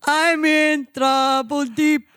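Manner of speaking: put-on slow comic Indian-accent voice. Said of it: "Don't do the voice".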